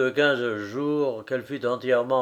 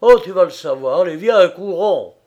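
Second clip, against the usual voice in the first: louder, quicker, pushing him to run; urgent; 4 semitones higher, 10 dB louder than usual. A narrator's reading. Agitated friend hurries Frédéric to her house, urgent. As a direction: rush him along, voice raised.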